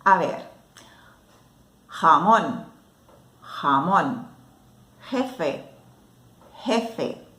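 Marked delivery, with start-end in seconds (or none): 1.9-4.3 "jamón" with a rough throaty j, xa-MON; teacher modelling "jamón", louder, jota clearly rasped; Spanish jota. Shown as a demonstration. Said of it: "La letra J pronunciamos como la G seguida de la E y de la I". 5.1-7.3 "jefe" with a throaty j, XE-fe; teacher modelling "jefe" with a rasped jota; Spanish jota. Shown as a demonstration. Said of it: "La letra J pronunciamos como la G".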